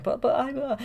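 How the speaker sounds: high, mock-worried stammer. Merryweather doubting her gift, imitated high worried stammer. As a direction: stumble over it in a high voice. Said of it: "really worried about it".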